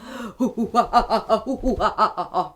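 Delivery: low imitated gorilla hooting; playful ape "hoo hoo ha ha"; gorilla hoots. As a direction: hoot like a gorilla for children. Imitates the gorilla just guessed.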